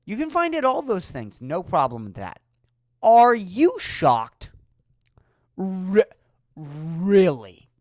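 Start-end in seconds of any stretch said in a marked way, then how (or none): 3.0-4.5 slow, loud, drawn-out disbelief; slow incredulous. Acted. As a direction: stretch every word, Are you shocked. Comic's incredulous rant.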